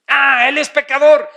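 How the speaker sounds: loud, high mock denunciation; accusing. Preacher mimicking the self-righteous judging another, accusing. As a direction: cry out the blame, pitched high.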